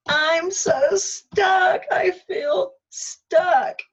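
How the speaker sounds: very slow, high, loud crying wail; wailing plea. Retold or imitated. Pouring out her stuckness to her inner voice, crying wail. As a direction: cry out the words as a desperate plea. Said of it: "magic phrase".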